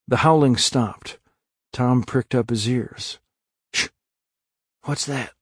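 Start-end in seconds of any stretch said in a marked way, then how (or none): 3.7-3.9 sudden hushing sound; sharp shush. A narrator's reading. Tom pricks up his ears, a warning shush. 4.8-5.3 soft, hushed alarm; whispering. A narrator's reading. Tom hears a new sound, whispered question. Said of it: "he whispered".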